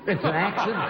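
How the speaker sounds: high, laughing repeat of It's an accident; laughing disbelief. Real, not acted. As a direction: echo it in amused disbelief.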